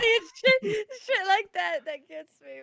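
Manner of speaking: laughing shout. Real, not acted. Cohost cracking up at a guest's joke, laughing shout. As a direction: burst out cracking up.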